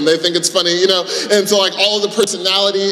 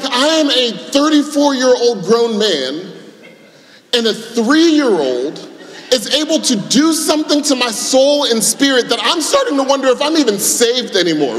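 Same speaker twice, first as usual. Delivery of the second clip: exasperated dad; 7 semitones higher than usual. Retold or imitated. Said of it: "And I'm like".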